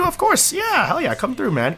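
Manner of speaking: ordinary talk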